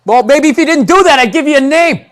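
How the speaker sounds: put-on high, loud back-talking kid; sassy kid. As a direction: talk back like a bratty kid.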